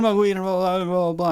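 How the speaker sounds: stammering it out to dad; panicked mumbling. Retold or imitated. Teen stammering to his dad, high and panicked. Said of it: "I was like".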